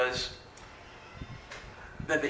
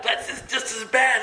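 laughing exasperation; 9 semitones higher, 3 dB louder than usual. Retold or imitated. Exasperated at the lady again.

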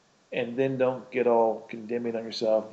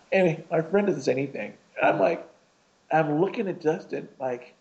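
usual speaking voice

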